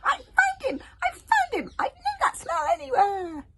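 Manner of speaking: very high, loud crowing; hogfly shouting at Snotlout; triumphant shout. Acted. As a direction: shout it out proudly.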